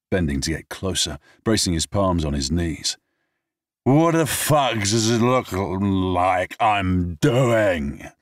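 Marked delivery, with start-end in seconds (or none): 3.8-8.1 high, slow, slurred comeback; drunken retort. Acted. Drunk man snaps back at James, slow and slurred. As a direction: drag the words out drunk.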